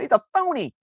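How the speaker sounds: high shrieking cartoon accusation; Simpsons cartoon voice. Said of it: "It's like in the, in The Simpsons".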